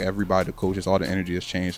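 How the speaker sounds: ordinary talk, nothing marked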